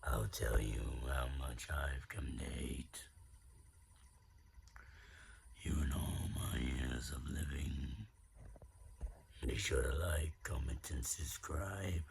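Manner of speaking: growled menacing machine villain monologue; Absolute Sin voice. Acted. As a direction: growl it out with cold hatred. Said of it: "That was the Am speech".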